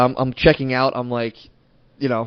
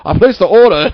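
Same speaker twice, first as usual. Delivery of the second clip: high, loud princely demand; imitating entitled prince; 6 semitones higher, 8 dB louder than usual. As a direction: announce it like spoiled royalty, as mockery. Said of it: "came in like a prince".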